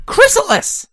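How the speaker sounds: high, loud accusing shout; angry shout. A narrator's reading. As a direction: yell her name in fury. Accusing call from above.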